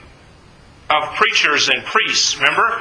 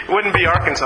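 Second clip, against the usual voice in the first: normal talking voice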